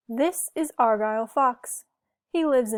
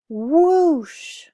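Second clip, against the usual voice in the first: loud whooshing wind; 6 semitones higher, 9 dB louder than usual. A narrator's reading. Wind blows the cards away.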